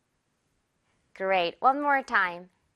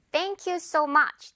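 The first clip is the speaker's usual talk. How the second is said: slow; 5 semitones higher, much slower than usual. Shown as a demonstration. Slow model phrase.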